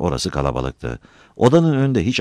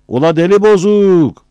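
high, stretched-out yell; loud, slow, high call; shouting; 9 dB louder than usual. Acted. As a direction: keep hollering the taunt from far away. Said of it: "buraya bağırıyordu habire".

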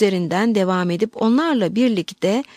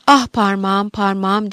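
ordinary delivery

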